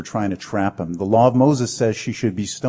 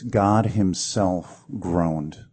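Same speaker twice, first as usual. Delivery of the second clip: God himself groaned drawn out very slowly, each word weighed; slow emphasis; 2 semitones lower, much slower than usual. Shown as a demonstration. Preacher to congregation, slow weighty emphasis. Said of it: "See, the really good news is this".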